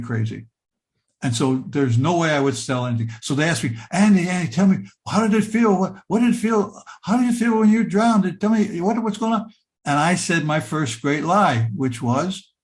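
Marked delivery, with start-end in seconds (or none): 3.9-9.5 eager questioning. Retold or imitated. People questioning the boy after he drowned, eager and high. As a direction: mimic curious people pushing for answers.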